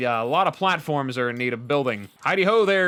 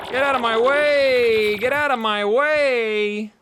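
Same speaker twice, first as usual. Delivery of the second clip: slow high shouted command; shouting; 9 semitones higher, 5 dB louder, much slower than usual. Real, not acted. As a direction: yell it at the enemy, drawn out. Fleeing boss in game.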